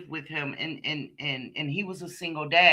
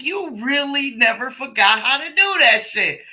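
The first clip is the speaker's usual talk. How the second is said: higher, a touch louder impressed voice; mock amazed boyfriend; 8 semitones higher, 5 dB louder than usual. Boyfriend marvelling as she rolls the Dutch, high mock awe. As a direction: imitate him gawking at her skills.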